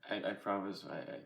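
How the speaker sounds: fighting tears, halting; choked up. Real, not acted. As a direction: voice catching, holding back tears. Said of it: "I wasn't gonna get emotional".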